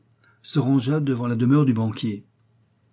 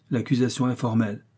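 ordinary delivery

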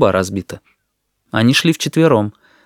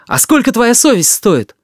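high, raised-voice challenge; indignant shout; 12 semitones higher, 4 dB louder than usual. A narrator's reading. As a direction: throw the question out indignantly. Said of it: "крикнул Алёшка Смирняков". (возмущённый вопрос на повышенных тонах)

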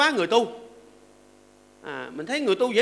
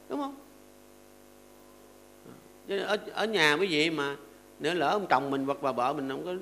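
ordinary talk

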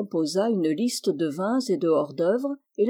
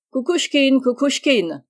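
loud, higher call for a servant; firm, resonant call; 5 semitones higher, 6 dB louder than usual. A narrator's reading. Captain summoning his orderly from the next room, firm loud call. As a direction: call out firmly and sonorously. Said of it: "cria d'une voix ferme et sonore".

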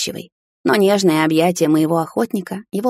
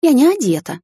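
higher, startled embarrassed cry; flustered, she blurts it out; startled protest; 8 semitones higher, 3 dB louder than usual. A narrator's reading. (смущённо и испуганно)

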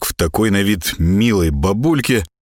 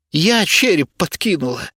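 grudging admission; 9 semitones higher than usual. A narrator's reading. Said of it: "явно недовольная своим признанием".

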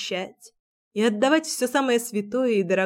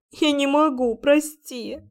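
sobbing apology; 5 semitones higher, slower than usual. Acted. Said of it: "рыдала навзрыд".

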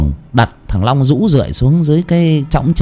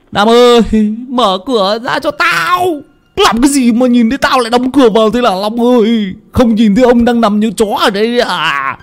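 put-on much higher, slurred drunken calling; drunk Long calling; 13 semitones higher than usual. Drunk Long yelling at Nam to open the gate.

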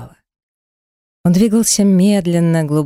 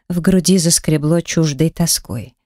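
plain reading voice

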